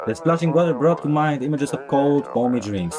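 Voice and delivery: projected, lilting announcer voice; storybook advert narration. Acted. Announcer opening a beer commercial, storytelling. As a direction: tell it like a fairy-tale commercial.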